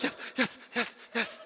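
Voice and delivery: whispery, panted yeses; eager little dog. Retold or imitated. Dog answering offer of a treat, eager panting. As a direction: pant it like an excited small dog.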